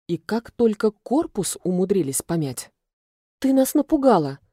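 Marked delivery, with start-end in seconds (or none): 3.4-4.4 higher-pitched reproach; scolding grumble. A narrator's reading. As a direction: scold someone who gave you a fright. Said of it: "Ведьма воздуха ворчала". (ворчливо, с упрёком)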